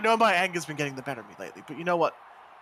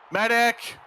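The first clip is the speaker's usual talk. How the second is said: louder and higher, shouting "medic"; calling for a medic; 4 semitones higher, 7 dB louder, slower than usual. Acted. Wrestler calling out for a medic after kicking his rival, shouting. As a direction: yell across the ring for help.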